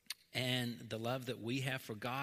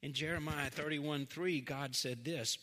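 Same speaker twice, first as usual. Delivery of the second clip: ordinary talk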